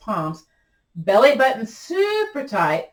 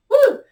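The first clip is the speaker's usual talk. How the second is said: excited woo; 7 dB louder than usual. Real, not acted. Exertion cheer mid exercise.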